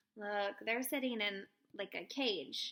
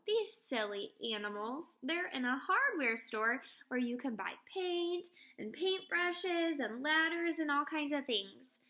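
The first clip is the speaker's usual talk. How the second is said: usual speaking voice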